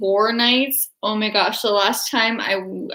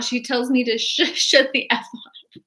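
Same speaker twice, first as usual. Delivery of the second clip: usual speaking voice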